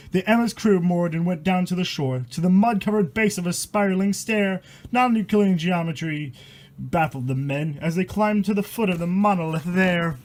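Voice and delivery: steady rhythmic verse read aloud; poem reading. A narrator's reading. Reading a rhyming horror poem to viewers, rhythmic storytelling.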